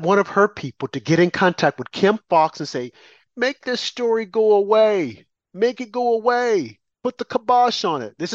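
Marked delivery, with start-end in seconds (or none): 3.4-8.1 higher, insistent imitation of an order; mock bossy order. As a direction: hand down a drawn-out mock command. Acts out Michelle's order by phone.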